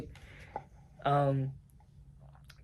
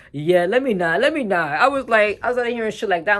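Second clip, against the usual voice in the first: excited; 7 semitones higher, 9 dB louder than usual. Real, not acted. Catching herself, laughing loudly.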